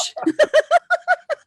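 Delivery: high, loud burst of giggling; high giggling fit; embarrassed laugh. Real, not acted. Embarrassed by the confession.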